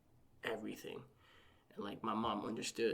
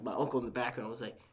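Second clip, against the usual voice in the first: normal talking voice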